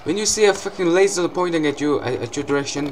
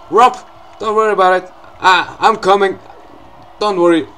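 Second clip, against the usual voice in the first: calling out; 5 semitones higher, 7 dB louder than usual. Pretend. Calls out to game character Romp.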